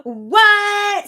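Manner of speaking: high, drawn-out disbelieving what; mock shock. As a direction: exclaim it in hammed-up surprise. Mock shock at turn of events.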